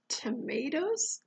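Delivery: ordinary delivery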